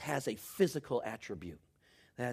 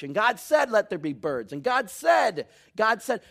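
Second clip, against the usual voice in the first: loud, rising refrain, slow and hammered; preaching at full force; 4 semitones higher, 9 dB louder than usual. Acted. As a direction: build each "And God said" higher and louder. Building preached Genesis refrain.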